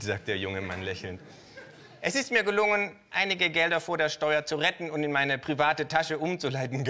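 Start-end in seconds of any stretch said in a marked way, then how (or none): 2.0-6.8 high, loud cheeky kangaroo voice; Känguru-Stimme. Acted. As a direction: play the brazen kangaroo, up high. Kangaroo voiced in live reading. (hohe, laute Känguru-Stimme)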